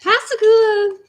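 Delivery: Pascagoula drawled high and loud in a thick Southern accent. Shown as a demonstration. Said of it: "I have a thick Southern drawl".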